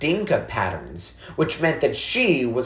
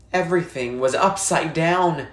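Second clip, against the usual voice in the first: plain reading voice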